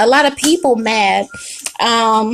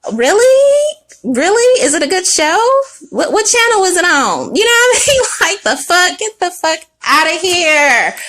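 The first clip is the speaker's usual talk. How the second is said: sarcastic, much higher; sarcastic disbelief; 6 semitones higher than usual. Pretend. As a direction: scoff in fake amazement.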